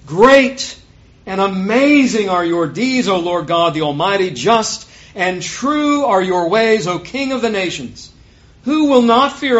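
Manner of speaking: loud, slow proclamation of praise; exultant scripture reading. A narrator's reading. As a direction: declaim it as a hymn of praise. Reads the song of praise aloud.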